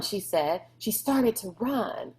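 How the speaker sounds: ordinary delivery, nothing marked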